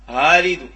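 آلد loud, second hamza softened toward alif; full first hamza in آلد, then a half-formed one; eased second hamza. Shown as a demonstration. Teacher demonstrating tashil to students, آلد with second hamza eased. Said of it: "ولكن الهمزة الثانية تنسهلوها".

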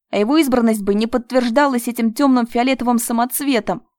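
being strangled, out of breath; choking gasp. Acted. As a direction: gasp it out with the last strength. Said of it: "Из последних сил выдохнул".

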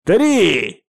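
high, drawn-out final count; shout. Acted. Pirate firing at the flagpole, shouting. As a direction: shout the last number before firing. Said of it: "крикнул Дерек".

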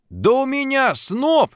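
high, drawn-out eager exclaim; nearly choking with delight; man thrilled at a chance; breathless joy. A narrator's reading. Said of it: "чуть не задохся Антошин от радости".

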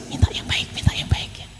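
hushed, secret coaching whisper. Acted. Father secretly prompting the child to ask nicely, whispered. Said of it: "Abahnya suruh bisik".

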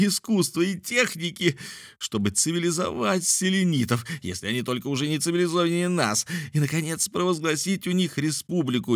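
high-pitched, fervent tirade; excited outcry. A narrator's reading. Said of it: "вскричал Мишель, подпрыгивая на целый метр". (восторженная тирада на высоких нотах)

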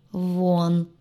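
held, level-pitched order to get out; tight, drawn-out dismissal; through gritted teeth. A narrator's reading. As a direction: force "вон" out through clenched teeth. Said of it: "процедила сквозь зубы".

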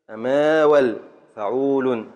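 "أماول فعول" slowly, long vowels drawn out; slow teacher's scansion, foot against pattern; scanning the verse. Shown as a demonstration. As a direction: stretch "أماول", then match it with "فعول". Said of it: "قطع لنا البيت".

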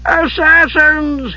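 high, long-drawn howl. A narrator's reading. Shocked monk at the door, howling in alarm. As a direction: howl the word out very slowly and high. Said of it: "he howled".

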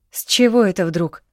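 taken aback by the sudden demand; surprised. A narrator's reading. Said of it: "удивилась девушка". (удивлённо, с повышением голоса)

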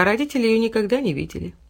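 ordinary delivery, nothing marked

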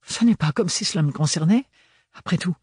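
a touch of huffy sarcasm; annoyed. A narrator's reading. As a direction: complain at being left out. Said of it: "s'agaça Ophélie".

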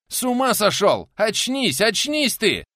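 very high, loud, alarmed shouting; frantic high-pitched shout; panicked guest pleading. A narrator's reading. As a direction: yell to bring a madman round. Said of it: "Попытался вразумить его гость".

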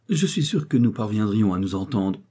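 usual speaking voice

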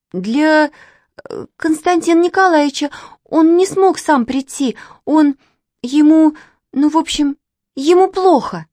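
high-voiced girl faltering for words; stumble, then blurt out the bad news; high and halting, rising to a blurt; hesitant, then blurting. A narrator's reading. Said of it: "выпалила сначала замявшись Катя".

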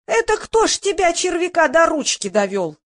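high-pitched indignant yell; yelling heckler. A narrator's reading. Woman in the first row berating Roman, yelling. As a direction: start high and drop at the end. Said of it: "завопила толстая тётка".

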